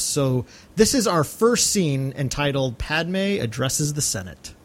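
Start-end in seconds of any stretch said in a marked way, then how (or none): none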